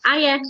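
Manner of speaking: "hiya" with a silent H; dropped H. Shown as a demonstration. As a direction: say "hiya" as "aya". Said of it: "we don't say our H's".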